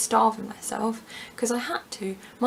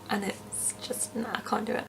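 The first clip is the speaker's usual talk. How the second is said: ordinary talk, nothing marked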